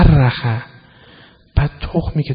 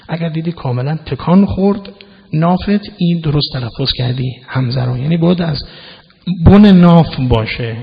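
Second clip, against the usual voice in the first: ordinary talk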